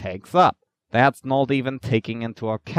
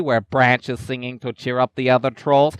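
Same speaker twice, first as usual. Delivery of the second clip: usual speaking voice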